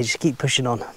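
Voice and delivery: ordinary delivery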